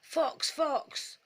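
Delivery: half-hearted practice yell of fox; sly rabbit trying out his false alarm; mock shout. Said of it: "I'll shout out".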